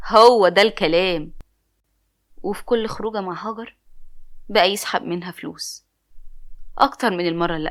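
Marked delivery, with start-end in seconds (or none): 0.1-1.3 loud, slow gloating approval; pleased laugh. Acted. Cousin gloats when the narrator agrees to the scheme, loud slow chuckle. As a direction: savor the win out loud. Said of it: "ساعتها ضحك وقال لي".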